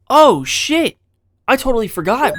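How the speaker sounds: loud, high startled cry; sudden realization. Real, not acted. Gamer suddenly remembers the forgotten shotgun, startled. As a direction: blurt it out as it hits you.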